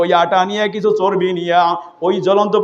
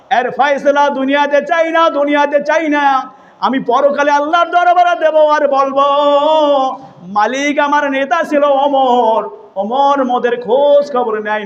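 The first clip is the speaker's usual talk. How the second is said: high chanted lament in a sermon; melodic preaching; 8 semitones higher than usual. Retold or imitated. Preacher voicing mother's complaint to God, melodic preaching.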